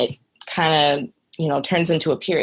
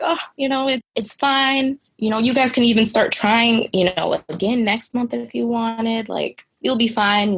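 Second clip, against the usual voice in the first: breezy, much higher, casual reassurance; dismissive doctor; 6 semitones higher than usual. Retold or imitated. Doctor telling the crying patient to just try again, higher and breezy. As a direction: wave it away cheerfully. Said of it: "And she's like".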